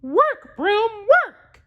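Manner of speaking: high cartoon mouse voice giving an order; Mickey commanding. Mickey ordering the broom in his high mouse voice. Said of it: "he said".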